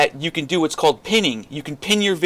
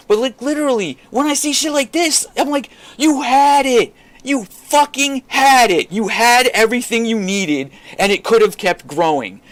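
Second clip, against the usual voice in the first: exasperated shout; 8 semitones higher, 3 dB louder than usual. Real, not acted. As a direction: berate them for blowing it. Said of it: "let me calm down for a second".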